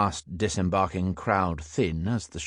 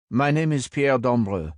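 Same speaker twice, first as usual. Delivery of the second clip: put-on higher-pitched French-accented introduction; 6 semitones higher, 4 dB louder than usual. A narrator's reading. French stranger naming himself to Sam, French accent. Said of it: "there was a French accent bubbling beneath it".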